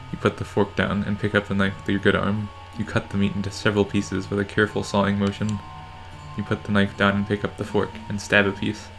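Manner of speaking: ordinary talk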